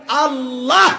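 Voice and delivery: high, loud rising Allah; loud call. Shown as a demonstration. Preacher leads the crowd's first call of God's name. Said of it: "গলা ভাঙ্গে মতো আওয়াজ দেন".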